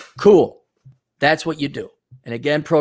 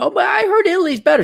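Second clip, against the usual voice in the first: high, airy voice of a flighty tourist; hammed-up whiny traveller; mock fickle traveller; 4 dB louder, faster than usual. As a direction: play a fickle wanderer, pitched way up. Mocking an imagined traveller's whim.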